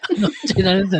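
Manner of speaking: fast, laughing protest; laughing denial. Real, not acted. Laughing at host, denying he is doing anything. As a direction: laugh it off quickly.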